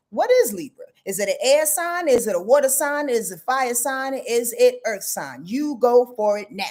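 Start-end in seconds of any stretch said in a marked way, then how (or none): none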